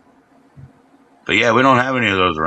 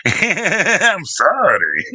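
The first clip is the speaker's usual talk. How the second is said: higher, very slow, giggling through it; laughing mock apology; 8 semitones higher, much slower than usual. Retelling his apology to his sister, laughing mock sorry. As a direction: stretch the sorry while laughing. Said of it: "And I was like".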